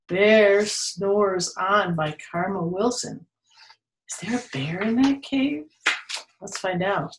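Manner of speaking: usual speaking voice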